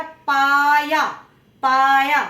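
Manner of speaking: पाया said very loud, पा and या both punched; loud drill of पाया for the class; both syllables stressed. Shown as a demonstration. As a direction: shout out पाया slowly, both syllables heavy. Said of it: "दोनों वर्णों पे जोर आएगा".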